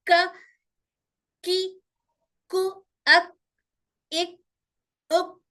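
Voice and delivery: क, की, कु, अक, इक, उक each with a crisp front k at the hard palate; kaf drill syllables. Shown as a demonstration. Teacher drilling kaf syllables for students, clear demo. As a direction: run through क की कु then अक इक उक with a clear front k. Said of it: "कहाँ रुक रही का की आवाज".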